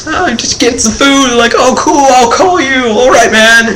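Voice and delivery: very high, tiny voice as if miles up; squeaky distant voice. Retold or imitated. Kid perched on the tall stool answers his friend in a very high squeak. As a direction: chirp it high like you're far above everyone.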